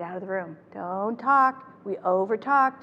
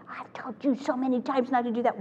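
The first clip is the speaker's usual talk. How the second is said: a lightly voiced scolding parent; mock exasperated nag; nagging parent; 5 semitones higher than usual. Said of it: "When we do that stuff".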